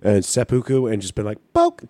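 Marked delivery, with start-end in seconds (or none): 1.5-1.8 tiny high-pitched poke; squeaky poke. Retold or imitated. Retelling a game kill in a squeaky voice.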